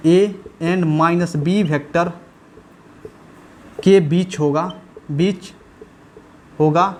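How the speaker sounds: word by word, long pauses between; slow, measured board dictation; dictating while writing. Acted. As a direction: say it out as you write each word.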